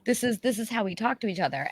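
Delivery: soft, high, gentle comforting; calm a scared visitor, hushed; soothing reassurance. Retold or imitated. Reassuring the frightened visitor that they are not mad, softly. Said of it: "I'm like".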